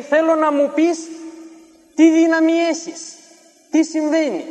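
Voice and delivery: high, loud pleading demand; urgent demand. Retold or imitated. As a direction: insist on knowing the secret. Sorcerer demands the believer's secret. (επίμονη, δυνατή απαίτηση του μάγου)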